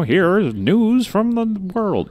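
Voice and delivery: high, very slow vintage newsreel announcer; old-timey news voice. Pretend. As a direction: announce it like a 1940s radio newsman. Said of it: "like, the old-timey news voice".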